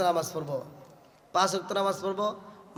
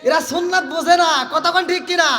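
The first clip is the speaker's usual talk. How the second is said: louder, higher preaching; heated preaching; 8 semitones higher, 9 dB louder than usual. Acted. Preacher scolding modern wives to the crowd, loud and high. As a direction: rise up and hammer it home.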